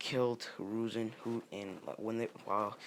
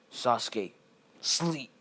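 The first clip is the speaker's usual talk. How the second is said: acted command, louder than the narration; forceful command; 2 semitones higher, 8 dB louder, much slower than usual. Naruto puts Sasuke under his genjutsu, forceful command. Character casts a spell on Sasuke.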